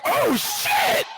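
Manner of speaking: falsetto oh shit, rising; dad echoing his son, squeaky; shocked curse. Retold or imitated. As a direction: squeal it in disbelief. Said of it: "I'm like".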